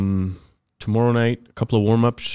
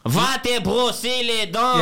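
slow, high Haitian French put-down; mock a Haitian driver's drawn-out, classier insult; imitated Haitian insult; 9 semitones higher, slower than usual. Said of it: "the Haitian was like".

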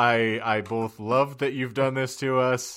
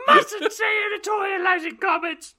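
very high squeaky elf voice; Dobby. Acted. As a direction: squeal it like a scolding little house-elf. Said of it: "I'm more like Dobby".

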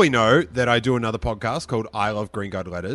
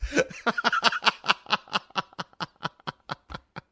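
long high giggle tailing away; helpless giggling; 9 semitones higher than usual. Real, not acted. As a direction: keep giggling, unable to stop. Still amused by own voice.

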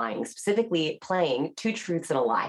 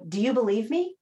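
asking for belief; 6 semitones higher than usual. Retold or imitated. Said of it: "liars want to know".

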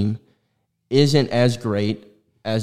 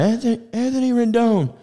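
heckling fan. Pretend. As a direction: taunt him from the stands, stretching it out. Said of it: "Angels game and be like".

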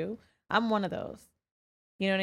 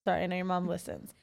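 ordinary talk

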